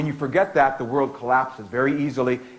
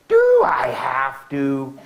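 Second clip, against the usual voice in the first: slow, whiny and snotty, dragged out and louder; 4 dB louder, much slower than usual. Shown as a demonstration. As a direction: whine it like a bratty kid who won't. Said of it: "say in a really snotty voice".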